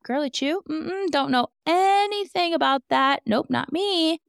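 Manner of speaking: high, airy denial, playing dumb; feigned innocence. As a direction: act like you've never heard the name. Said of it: "But she says".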